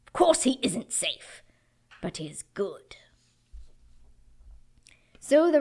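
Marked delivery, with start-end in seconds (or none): 0.1-3.0 lively read-aloud with voiced characters; story reading. Preacher reading Narnia passage to congregation, dramatic. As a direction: read it like a bedtime story, big and hushed by turns.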